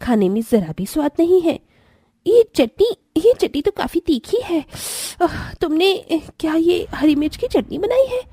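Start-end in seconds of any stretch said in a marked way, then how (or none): 2.3-4.6 much higher cry at the spice; woman reacting to too-spicy chutney, higher; burning mouth. Chutney burns her mouth. 5.2-8.2 higher, pained complaint; spicy pain. Acted. Still reeling from spicy chutney.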